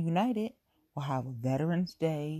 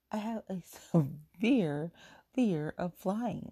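drawn-out, dead serious; slow, earnest emphasis; 5 semitones higher, slower than usual. Real, not acted. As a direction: stress on severe and fear, slowly. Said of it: "It's not even funny".

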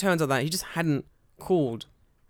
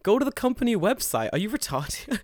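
higher, mock-outraged retold rant; mock exasperated rant; 8 semitones higher, 3 dB louder than usual. Retelling his reply to a clueless recruiter, mock outrage. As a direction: play up the disbelief at the caller.

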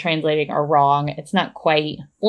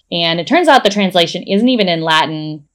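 normal talking voice

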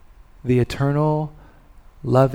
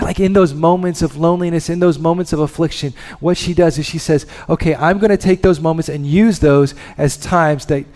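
loud, higher, driving sermon voice; loud rising sermon build; impassioned preaching; 4 semitones higher, 7 dB louder than usual. Acted. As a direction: preach it hard to the room. Preaching at full force.

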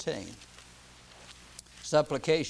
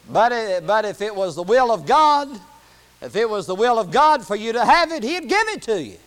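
loud, raised pulpit voice; emphatic preaching; 6 semitones higher, 10 dB louder than usual. Real, not acted. Preacher telling the church about God's will, emphatic preaching. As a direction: insist on it, leaning on the key words.

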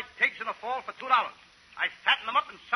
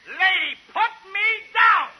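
loud slow laughing yell; shouting in alarm; 7 semitones higher, 6 dB louder, much slower than usual. Acted. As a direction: yell to be put down, half laughing. Woman grabs him.

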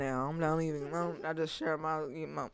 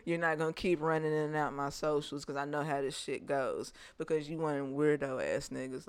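normal talking voice

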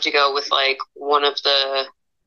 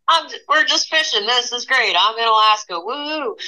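carefree glee; 7 semitones higher than usual. Retold or imitated. Retold carefree joy in canoe.

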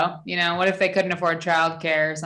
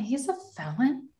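much softer, stunned repeat; hushed disbelief; 5 semitones higher, 9 dB quieter than usual. Real, not acted. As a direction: say it under your breath in shock. Repeats shocked question.

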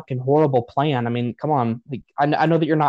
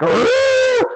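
imitating a roar, much higher and louder; mock battle roar; 4 dB louder than usual. Imitating a character charging into a portal with a yell. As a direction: let out a hammed-up war cry. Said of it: "And he's like".

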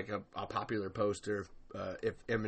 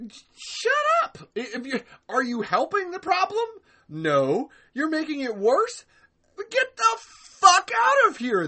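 very high, loud screeching mock rant; mock outraged yell; 8 dB louder than usual. Fans yelling at the banning admin, hammed-up shrieking outrage. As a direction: blow up at him in a hammed-up screech. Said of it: "it's just kinda like".

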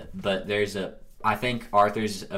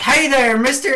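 much higher, louder chirpy greeting; mock friendly greeting; 14 semitones higher, 6 dB louder than usual. Retelling the game greet button, high chirpy Arthur. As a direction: sing out a hello. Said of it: "he's like".

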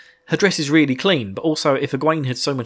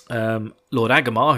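usual speaking voice

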